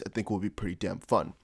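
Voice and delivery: quiet tossed-off aside; muttered aside. Acted. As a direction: say it under your breath as an afterthought. Repeats line as quiet aside.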